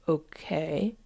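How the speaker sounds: drawn-out, tentative rising okay; voice of the emotion. Acted. Emotion starting to accept, tentative question. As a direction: test the word slowly, unsure. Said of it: "And then the emotion says".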